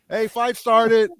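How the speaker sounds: high, loud, drawn-out call; Joey. Retold or imitated. Sitcom friend alerting the watch party, mock calling out. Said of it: "Joey, he's like".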